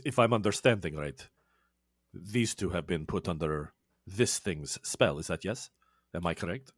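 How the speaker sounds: low, soft, measured threat of a vampire; low menacing vampire. Acted. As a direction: speak low and cold as a killer vampire. GM voices Edmundo, low and soft.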